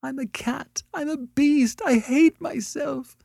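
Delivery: very high, hammed-up wailing; desperate sobbing. A narrator's reading. Annette berates herself to Beverly, sobbing desperately. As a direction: wail each confession as if sobbing. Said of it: "she sat in her chair and sobbed desperately".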